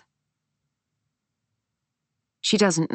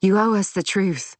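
indignant demand, higher-pitched; snapping; 5 semitones higher than usual. A narrator's reading. Daughter demanding the truth from her mother, snapping. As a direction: demand it with contained anger. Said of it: "snaps Lorna".

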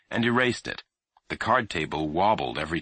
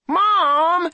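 loud high outraged cry; protesting; 9 dB louder than usual. A narrator's reading. Boy protesting to his mother in the dark, loud. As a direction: cry out at the lights going off. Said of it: "he protested".

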